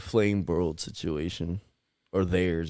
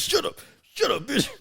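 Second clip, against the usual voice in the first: very high-pitched mock telling-off; scolding cat. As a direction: squeal the order as an angry cat. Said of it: "Man said".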